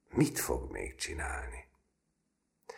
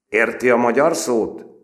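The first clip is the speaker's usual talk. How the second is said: high, loud menacing question; loud stern interrogation; stern demand; 10 semitones higher, 14 dB louder than usual. A narrator's reading. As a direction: press the frightened boy. Sergeant interrogates recruit.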